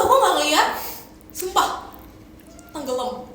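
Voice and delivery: ordinary talk, nothing marked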